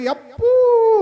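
long, loud, high "yappoo" howl; mock cry of pain. Preacher mimicking someone jabbed with a needle, mock cry of pain. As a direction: draw out a loud, hammed-up yelp.

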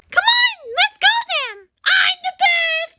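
high, boastful cartoon voice; eager little character; excited toy puppy. Toy puppy urges playmate on.

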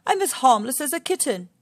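a lion promising earnestly, lightly voiced; pleading Lion.

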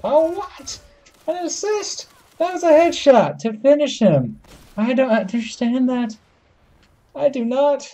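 high-pitched disbelief; incredulous protest. Real, not acted. Gamer robbed of a headshot kill, high incredulous protest. Disbelief at getting only an assist.

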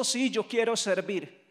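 normal talking voice

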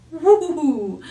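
high, wobbling whoop; playful cheer. Pretend. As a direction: cheer for toddlers after a countdown. Celebrating after the scarf count.

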